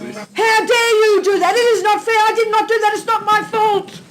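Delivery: put-on stamp your foot like a wronged little kid; angry child. Child running to mummy about Johnny, mock angry whine. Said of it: "Say the words of anger".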